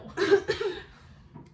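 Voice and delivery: high loud laugh; burst of laughter. Real, not acted. Reacting to a friend's disbelief with a high burst of laughter. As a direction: let a big laugh burst out.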